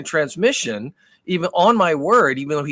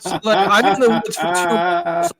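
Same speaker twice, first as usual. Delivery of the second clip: higher, louder, laughing through the words; 3 semitones higher, 4 dB louder than usual. Real, not acted. Telling his friend about the ruined trip, laughing. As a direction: laugh it out at your own bad luck.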